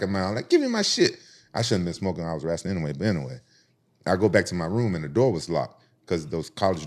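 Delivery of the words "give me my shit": mock demand. Demanding cigar back.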